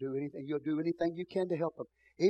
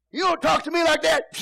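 much higher, much louder indignant cry; offended outburst; 12 semitones higher, 10 dB louder than usual. Retold or imitated. Rebuked person yelling at the pastor before hanging up, indignant shout. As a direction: bristle and snap back at the preacher.

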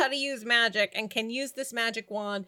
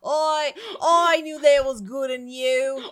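put-on louder second stab at an Australian accent; attempted Australian accent; 6 dB louder than usual. Belle hugging Rumple, badly faked Australian accent. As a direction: push the fake Australian vowels on a heartfelt line. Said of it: "I can't speak with an Australian accent".

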